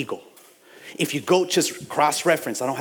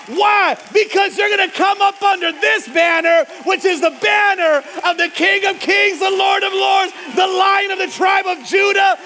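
much higher and louder preaching; preaching at full force; 14 semitones higher, 4 dB louder than usual. Real, not acted. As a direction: roar it to the congregation.

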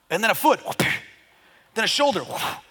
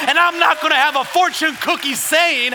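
raised, driving declaration building in intensity; full-force preaching; 8 semitones higher, 6 dB louder than usual. Real, not acted. Preacher declaring God's word to the church, full-force preaching. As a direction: preach it with forceful punched phrases.

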